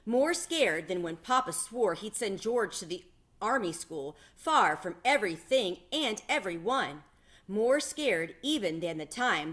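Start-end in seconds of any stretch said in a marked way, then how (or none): none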